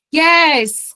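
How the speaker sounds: drawn-out loud, high "yes"; delighted. Real, not acted. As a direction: burst out with a long cheer of approval. Delighted at quiz result.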